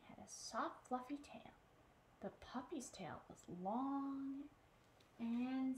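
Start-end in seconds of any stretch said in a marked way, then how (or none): none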